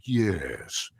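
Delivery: slow, drawn-out villain yes; stretched-out yes. Shown as a demonstration. Actor shows how he stretched the Megatron yes, slowly. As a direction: let the yes hiss out slowly. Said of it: "but I did stretch it out".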